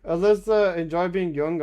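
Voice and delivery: ordinary delivery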